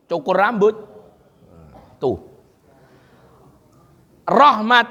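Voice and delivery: ordinary talk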